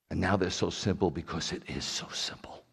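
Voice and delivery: low near-whisper; hushed confiding. Real, not acted. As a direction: drop right down as if confiding. Hushed emphasis on simplicity.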